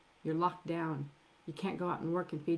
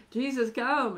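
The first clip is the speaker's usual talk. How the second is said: urgent call; 6 semitones higher, 7 dB louder than usual. Acted. Sisters calling for Jesus.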